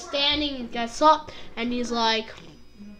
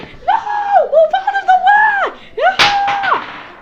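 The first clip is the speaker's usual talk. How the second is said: very high, loud battle yell; frantic mock shout; 8 dB louder, much slower than usual. Goku rushes into the fight, high hammed-up yelling. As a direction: yell it like a hero charging in.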